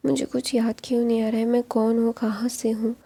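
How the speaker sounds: plain reading voice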